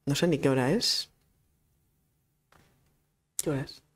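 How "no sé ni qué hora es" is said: usual speaking voice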